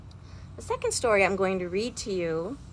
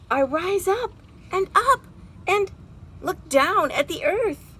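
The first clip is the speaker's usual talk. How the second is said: rising excitement; 11 semitones higher, 6 dB louder than usual. Acted. Robin finally flying upward.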